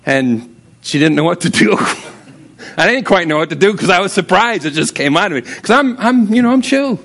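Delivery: usual speaking voice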